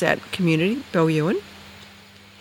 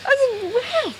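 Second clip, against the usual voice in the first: amazed. Retold or imitated. Amazed at owl answering back.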